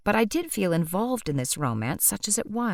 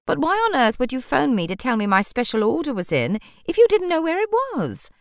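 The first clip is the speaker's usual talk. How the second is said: exasperated demand rising high and louder at the end; a bossy customer's indignant question, pitch climbing; irritable, overbearing; 7 semitones higher, 5 dB louder than usual. Acted. Customer scolding shopkeeper about her lost order, indignant. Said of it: "irritable and overbearing".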